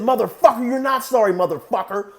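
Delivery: loud, high acted fury; heated mock outrage; angry retort. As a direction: throw the apology back in his face, yelling.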